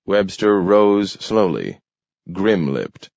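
ordinary delivery, nothing marked

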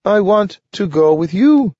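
a small eager dog, voiced high and bright-eyed; Nathaniel the dog. A narrator's reading. Dog Nathaniel relieved to find Grant awake, high dog voice. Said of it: "Nathaniel told him".

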